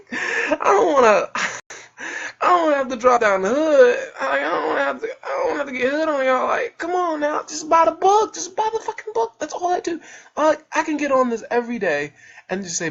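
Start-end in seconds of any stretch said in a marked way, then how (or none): 0.1-6.5 high, loud, whiny mock crying; mock wailing. Mock tantrum about dropping proper speech. 6.5-10.0 high-pitched pleading whine; exasperated begging. Real, not acted. Podcaster begging viewers to buy the book, high exasperated plea.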